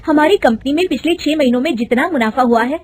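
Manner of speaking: a touch of alarm at the fraud; urgent report. Acted. Daughter-in-law reveals the fraud to her mother-in-law, rising urgency.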